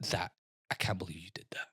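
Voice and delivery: hushed whispered scolding; whispered reproach. Retold or imitated. The woman next to him, whispering reproach. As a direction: whisper it, appalled.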